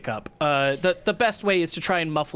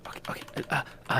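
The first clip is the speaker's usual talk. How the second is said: whispered; 9 dB quieter, much faster than usual. Acted. GM whispers after the shush.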